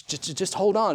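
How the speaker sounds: put-on hushed, soothing a frightened child; calm the boy down gently; a father hushing his son. Isaiah comforting his son in courtroom.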